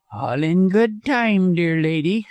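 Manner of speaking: high, slow, harsh put-off. A narrator's reading. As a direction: put her off harshly, dragging the words. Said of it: "said Busham harshly".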